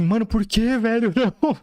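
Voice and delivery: high, bewildered voice for the dog; dog's imagined voice. Retold or imitated. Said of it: "com uma cara, tipo assim".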